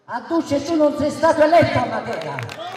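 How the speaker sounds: high, loud accusing shout; booming balcony speech. Real, not acted. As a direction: hurl it at the crowd. Shouting attack on opponent "Duce.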